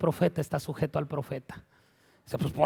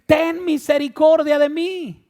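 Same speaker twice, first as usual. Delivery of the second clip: high, slow shouted plea; drawn-out yell for mercy; crying out; 9 semitones higher, 7 dB louder, slower than usual. Acted. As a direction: call out loudly, stretching the words. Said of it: "comenzó a dar voces y decir".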